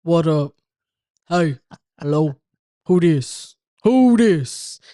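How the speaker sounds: loud, put-on gruff phone voice; disguised tough voice. Screening unknown callers on the phone, loud disguised tough voice. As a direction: bark it out like a tough guy screening calls. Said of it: "answer it with a disguised voice".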